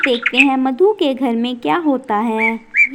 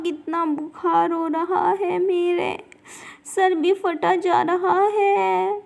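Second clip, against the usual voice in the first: slow, soft, weak tearful moan; a feverish woman crying through the pain; barely getting the words out between sobs; sick and crying; 5 dB quieter, slower than usual. Acted. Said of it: "चंदा बीमारी की वजह से रो रही है".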